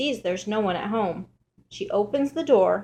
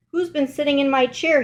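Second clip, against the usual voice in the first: lightly voiced indignant father bear, pitched higher; a touch of put-out papa bear, voice raised in pitch; 6 semitones higher, 4 dB louder, faster than usual. A narrator's reading. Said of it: "says Father Bear".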